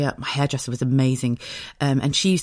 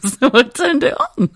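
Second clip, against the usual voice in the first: slow, high words dissolving into laughter; laughing; 11 semitones higher, 4 dB louder than usual. Real, not acted. Confessing the digger joyride, laughing. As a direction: barely get it out for laughing.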